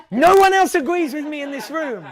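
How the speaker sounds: high, loud mock indignation; indignant protest. Host complaining that the room won't agree, indignant. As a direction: protest loudly to the whole room.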